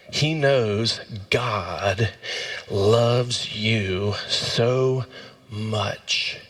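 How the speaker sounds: very slow, word by word; slow emphatic declaration. Real, not acted. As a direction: draw out each word and let it land. Slow, weighty declaration of love.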